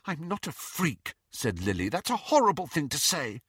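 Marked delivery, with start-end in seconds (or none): 0.1-1.1 high, soft, wounded voice; hurt protest. A narrator's reading. Lily hurt, eyes filled with tears. 1.9-3.4 high, wounded reproach; hurt protest. A narrator's reading. Lily hurt by the insult.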